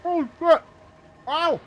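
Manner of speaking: high, loud pained cries; real yelp of burning pain. Real, not acted. Burnt by hot food.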